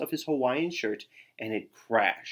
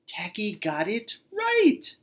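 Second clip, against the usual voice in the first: higher, slowed proud announcement; triumphant; 9 semitones higher, slower than usual. A narrator's reading. Narrator announcing Tacky's success, proud and slow. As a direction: savor the success.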